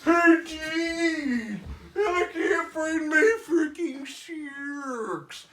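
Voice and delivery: shouting husband. Retold or imitated. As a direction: yell up to your wife. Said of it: "And then Stu's like".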